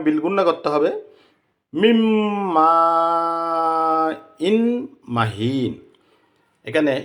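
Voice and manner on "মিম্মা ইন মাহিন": মিম্মা with hummed m and long drawn aa, falling on মাহিন; merged noon with ghunnah. Shown as a demonstration. Teacher showing how to recite it, merged and elongated. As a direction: hold the double m and stretch the vowel of মিম্মা. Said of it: "এভাবে উচ্চারণ হবে".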